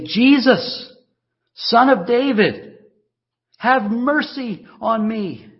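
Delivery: loud, high pleading cry. A narrator's reading. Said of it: "he began to cry out".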